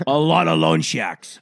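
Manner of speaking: loud, slow mock Bostonian; put-on Boston accent. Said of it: "That's when your Boston accent, accent gets lower".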